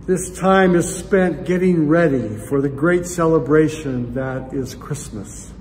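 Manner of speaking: ordinary talk, nothing marked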